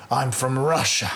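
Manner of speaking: barely there Russian accent, imitated; failed Russian accent. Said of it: "do a Russian accent".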